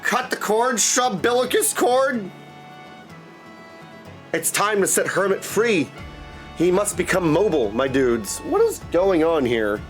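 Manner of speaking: ordinary delivery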